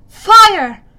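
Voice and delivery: very loud, high battle command; shouted order. A narrator's reading. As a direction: bark the command to shoot. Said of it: "Zoe ordered".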